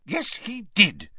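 high, slow, indignant retort; snapping. A narrator's reading. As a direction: snap it back, stressing each word. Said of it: "snapped Ogden".

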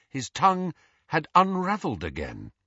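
ordinary delivery, nothing marked

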